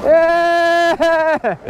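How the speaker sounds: high whooping yeah; excited cheer. Real, not acted. Cheering while riding.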